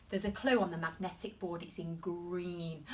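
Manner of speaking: ordinary talk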